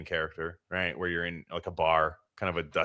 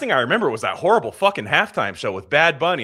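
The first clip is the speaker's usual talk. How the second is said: loud, higher-pitched baffled protest; exasperated newcomer; 6 semitones higher, 6 dB louder than usual. Acted. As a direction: protest your confusion, voice pushed up. Newcomer pleads his confusion to Satan.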